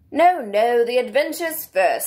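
impatient. A narrator's reading. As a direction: cut in insistently, with impatient emphasis. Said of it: "in an impatient tone".

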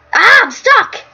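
high, loud yell of alarm; startled scream. Real, not acted. Player suddenly stuck in the game, screaming. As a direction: cry out in sudden panic at being stuck. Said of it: "Sorry for the screaming".

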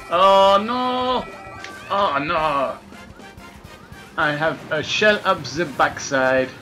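ordinary talk, nothing marked

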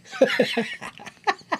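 high airy giggle; breathy laugh. Real, not acted. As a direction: burst into laughter. Laughing at the name.

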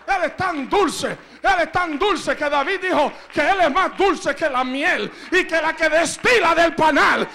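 loud, driving sermon voice; fiery preaching. Acted. Preacher drives the congregation to worship. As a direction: preach it with full force.

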